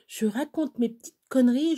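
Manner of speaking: normal talking voice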